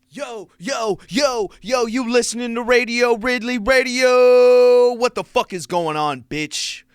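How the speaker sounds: loud, high, drawn-out host shout; high-energy radio hype yell; hyped show opener. Acted. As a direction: open the show yelling, stretching the name out. Shouts the show's opening.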